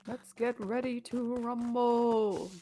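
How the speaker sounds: slow hype-man intro, held back in volume; mock ring announcer. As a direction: stretch it out like a restrained fight announcer. Mimics the famous ring announcer's call.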